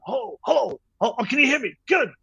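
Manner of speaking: high-pitched put-on American phone voice; imitated older man struggling to be heard; Francis Ford Coppola on the phone. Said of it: "he got on the phone again".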